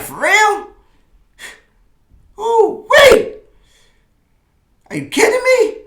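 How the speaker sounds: high, loud, incredulous yelp of surprise; shrill disbelief, cranked high and loud; stunned disbelief. Retold or imitated. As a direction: throw your voice up high in shock, kidding me. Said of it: "I'm like".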